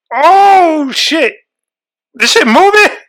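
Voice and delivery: high, loud, frightened yelp; high-pitched panicked cry; panicked squeal. Real, not acted. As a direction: shriek it as the bug seems to move. Panicking over bugs.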